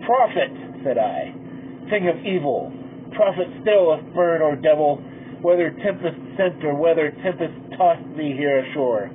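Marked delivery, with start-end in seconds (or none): none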